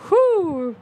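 high, drawn-out whoo; exclaimed whew. Acted. Comic reacting to overhearing her sister, high whew. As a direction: let out a high relieved whew.

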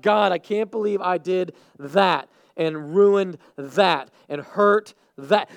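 loud, slow cry to God; impassioned acted lament; anguished regret. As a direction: cry out in self-reproach, leaning on each phrase.

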